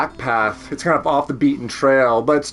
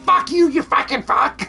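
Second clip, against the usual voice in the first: high, squawky cursing bird voice; high-pitched mock bird rant; screeching bird; 13 semitones higher than usual. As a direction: imitate an outraged bird swearing in screeches. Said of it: "screech some more".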